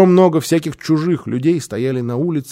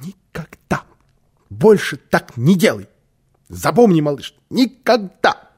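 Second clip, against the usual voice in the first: higher, emphatic tearful plea; through tears; 6 semitones higher, 5 dB louder than usual. A narrator's reading. Sister to the little boy just found, tearful pleading. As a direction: plead loudly, lightly acting the tears. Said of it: "проговорила сквозь слёзы".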